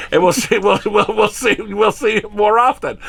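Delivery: laughing through the words; chuckling while speaking. Real, not acted. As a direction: giggle it out, amused at your own joke. Laughing at his own joke.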